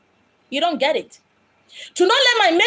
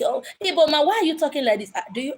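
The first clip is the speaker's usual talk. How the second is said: usual speaking voice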